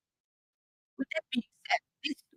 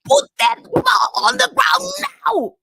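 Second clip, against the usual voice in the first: put-on much higher, louder and slower, bellowed at a child; full-throated furious yell, much higher and louder; retold angry shout; 8 dB louder, slower than usual. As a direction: yell it down at the kid like an angry coach. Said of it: "He just shouted in his child's face".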